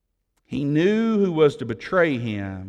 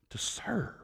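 whispered word; 7 semitones higher, 14 dB quieter than usual. Acted. Preacher whispering the point to the congregation, hushed. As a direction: breathe the words out.

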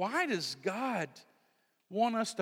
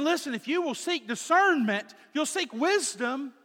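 higher, louder, urging call; emphatic urging; 10 semitones higher, 6 dB louder than usual. Retold or imitated. Father urging his son to seek wisdom, emphatic. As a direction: press the point like a father. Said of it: "He said".

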